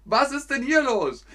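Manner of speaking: mock alarm.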